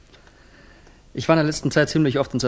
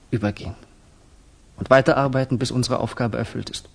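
ordinary delivery

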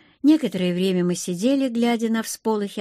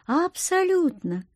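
slow, high, frosty reply; 8 semitones higher, slower than usual. A narrator's reading. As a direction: draw it out with a touch of frost. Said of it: "В моём голосе шелестела позёмка". (с лёгкой ледяной позёмкой в голосе)

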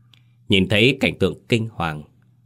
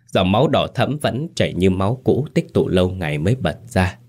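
ordinary delivery, nothing marked

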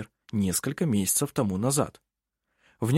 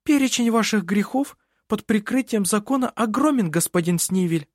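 much higher, louder proud woman's voice; proud young woman; 11 semitones higher, 4 dB louder than usual. A narrator's reading. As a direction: Vinga answers the judge with pride. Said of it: "произнесла Винга". (Винга гордо отвечает судье)

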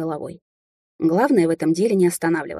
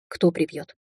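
mildly confused, understated; bewildered; slower than usual. A narrator's reading. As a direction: ask it at a loss. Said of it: "растерялась я".

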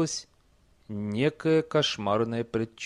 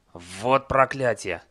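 slow, drawn-out curse; cursing troll; much slower than usual. A narrator's reading. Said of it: "выругался он". (медленное ругательство тролля)

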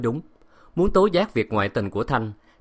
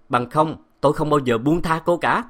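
louder, menacing husband; threatening husband; 6 semitones higher, 4 dB louder than usual. A narrator's reading. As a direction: threaten her.

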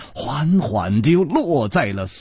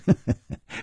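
smiling chuckle. Acted. Nangong Ling answers his accuser, smiling chuckle. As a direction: chuckle lightly before speaking. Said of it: "南宫灵微笑着说".

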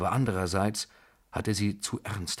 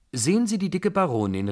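high, loud exclamation; calling out; 9 semitones higher, 7 dB louder than usual. A narrator's reading. She points out the fat baroness, calling out. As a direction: call out excitedly, pitch jumping up. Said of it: "rief sie".

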